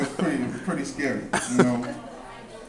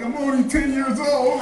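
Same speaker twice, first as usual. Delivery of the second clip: put-on little kid voice; 10 semitones higher, 3 dB louder than usual.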